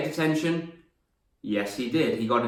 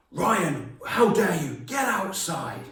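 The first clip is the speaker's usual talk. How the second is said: higher-pitched, outraged teacher act; mock telling-off; 6 semitones higher, slower than usual. Teacher sending Ryan out of class, mock outrage. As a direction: imitate a scandalised teacher ordering someone out.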